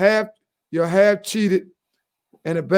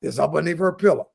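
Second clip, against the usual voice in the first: normal talking voice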